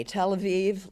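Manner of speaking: ordinary delivery, nothing marked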